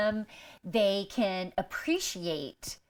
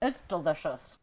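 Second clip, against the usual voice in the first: crunching chips; 4 semitones lower than usual. Real, not acted. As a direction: mouth full, chewing. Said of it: "I'm crunching so loud".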